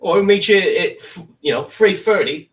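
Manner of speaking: high, slow mock Essex accent; a hammed-up Essex accent that slips toward pirate; bad Essex accent. Shown as a demonstration. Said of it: "I just say oi".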